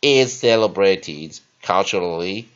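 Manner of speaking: loud, deliberate model reading in English; measured classroom reading with punched key words; teacher's read-aloud. Shown as a demonstration. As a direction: project each phrase firmly for learners to repeat. Reads the lesson text for practice.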